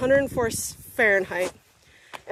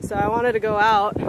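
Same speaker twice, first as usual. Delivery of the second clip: ordinary delivery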